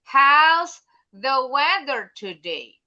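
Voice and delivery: "How's" punched loud, slow fall on "today"; model question tune. Shown as a demonstration. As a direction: show the pupil how the question goes down. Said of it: "dikit lagi intonasinya".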